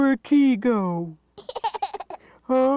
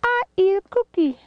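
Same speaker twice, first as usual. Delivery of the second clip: high, fast squeaky character voice; high toy voice; 13 semitones higher, much faster than usual. Pretend. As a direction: play a tiny cartoon critter. Voicing a toy in play.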